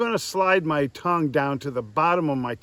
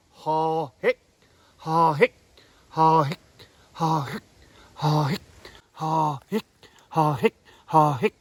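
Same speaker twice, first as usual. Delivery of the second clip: goose moan and cluck. Shown as a demonstration. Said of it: "And what we're doing is".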